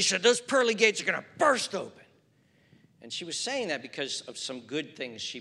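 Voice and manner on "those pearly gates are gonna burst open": mock-gushing, a little louder, "burst" leaned on; overexuberant aunt. Elderly aunt praising her nephew on the phone, a touch overexuberant. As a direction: gush it like a delighted aunt. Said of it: "she got very overexuberant".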